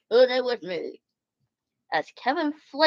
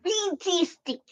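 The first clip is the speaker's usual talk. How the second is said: high squeaky exclamation; high delighted squeak; 10 semitones higher, 3 dB quieter, slower than usual. Real, not acted. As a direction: squeal it with joy.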